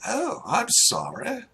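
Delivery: hammed-up aristocratic recognition; insistent duchess. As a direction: insist you know this stranger. Game master plays the noble.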